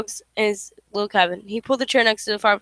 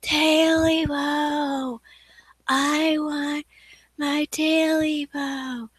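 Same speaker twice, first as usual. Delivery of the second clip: slow, high, drawn-out moaning call; slow ghostly wail; eerie ghost voice; 5 semitones higher, much slower than usual. Acted. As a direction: moan it like a hungry spirit in the dark. Ghostly voice in the night.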